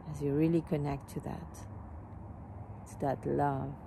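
calm meditation voice, phrases spaced by long pauses, softening as it goes; guided meditation. Real, not acted. Teacher leading a smiling heart meditation, calm and unhurried. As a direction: guide them gently into the heart, letting phrases fade.

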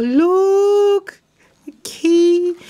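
high, drawn-out delighted squeal; thrilled discovery. Real, not acted. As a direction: squeal at a lovely find. Spots a key among gifts.

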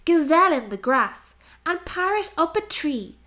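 usual speaking voice

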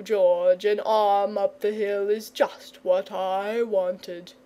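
slow, drawn-out dragon voice; self-righteous dragon. A narrator's reading. Dragon reproaching George on the boy's behalf, self-righteous. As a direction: take charge in a slow, indignant drawl.

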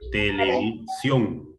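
"televisión" slowly split as te-le-vi-sión; syllable by syllable. Shown as a demonstration. Teacher checking the stressed syllable, syllable by syllable. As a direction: separate each syllable of "televisión", landing on sión. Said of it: "es la sílaba tónica".

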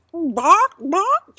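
two loud, high rising clucks; high-pitched, emphatic "bawk, bawk"; furious chicken squawks. Acted. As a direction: squawk it emphatically like a worked-up hen. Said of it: "The chicken nods furiously".

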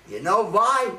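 loud, booming voice from the heavens; booming God voice. Acted. God booms down at the struggling rabbi. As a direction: boom it down like God from above. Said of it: "a deep voice says".

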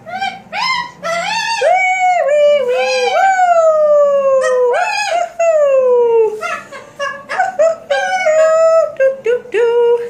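playful whee. Real, not acted. Mother pushing her baby on the swing, gleeful high sing-song.

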